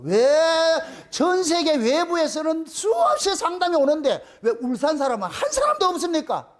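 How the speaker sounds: high-pitched aggrieved questioning; indignant protest. Retold or imitated. Preacher retelling how he challenged God, indignant protest. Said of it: "하나님 앞에 한번 따진 적이 있거든요".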